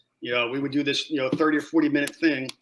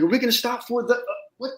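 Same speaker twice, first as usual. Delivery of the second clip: incredulous surprise; 9 semitones higher, faster than usual. Retold or imitated. Surprised at convoy not stopping.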